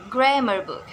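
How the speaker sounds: grammar book with GRAMMAR stressed; stress on first word. Shown as a demonstration. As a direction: hit GRAM in grammar book, then drop. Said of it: "stress lies in the first word".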